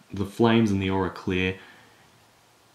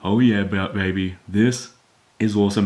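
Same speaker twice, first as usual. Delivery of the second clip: excited cheer, first words louder; excited fan reaction; 4 dB louder than usual. Acted. Viewer reacting to Vegeta's speech, excited. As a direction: act out a fan getting hyped. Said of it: "get excited and just be like".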